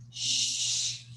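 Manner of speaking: "Shh" hissed unvoiced sh sound. Shown as a demonstration. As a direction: hold the "Shh" hiss steady. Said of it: "demonstrating sound sh".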